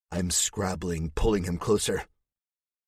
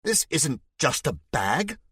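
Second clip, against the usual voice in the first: shouted protest; 9 semitones higher than usual. Acted. Man shouts at his angry rescuer to defend the bag. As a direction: shout back defensively, voice cracking up. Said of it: "he shouts".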